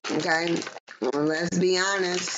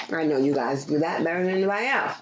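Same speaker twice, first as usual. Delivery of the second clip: ordinary delivery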